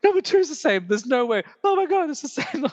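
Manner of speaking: high, rising squeals of disbelief; excited disbelief. Retold or imitated. Friends comparing identical film lists, squealing with excitement. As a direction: gush it out as each match lands.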